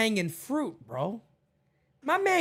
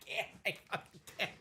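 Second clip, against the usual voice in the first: laughing; 5 semitones lower, 18 dB quieter, faster than usual. Real, not acted. Helpless laughter.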